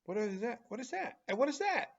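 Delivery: high, bright-toned playful asking; playful quiz voice. Acted. Teacher teasing young learners to guess the picture. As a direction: ask like a game show for kids.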